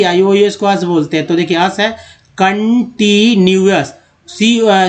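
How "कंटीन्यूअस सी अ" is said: "Continuous" slowly, split into its parts; syllable-by-syllable reading. Shown as a demonstration. Teacher joining the taught parts into one word, slow syllables. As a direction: stretch out कं-टी-न्यूअस for learners. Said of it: "कन बोलते हैं तो कंटी".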